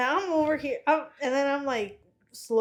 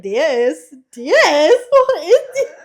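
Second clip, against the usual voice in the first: confused repeat; 5 semitones higher, 9 dB louder, much slower than usual. Retold or imitated. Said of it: "She was like".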